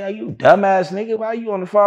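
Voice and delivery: high-pitched exasperated scolding; high retold rebuke. Retold scolding over recorded call.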